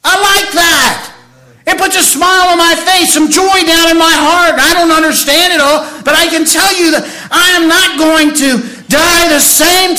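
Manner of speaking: high, loud joyful preaching; preaching at full force. Acted. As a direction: lift it up with joy. Preaching joy, raised.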